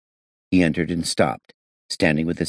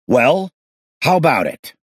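loud, impatient demand; 10 semitones higher, 6 dB louder than usual. Acted. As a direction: push him for an answer. Detective demands an answer.

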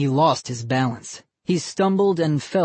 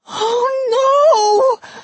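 much higher, louder scream with a sob; horrified crying shout; 8 dB louder than usual. A narrator's reading. Molly sees her drowned doll, horrified crying scream. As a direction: shriek in horror at the sight. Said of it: "horrified scream".

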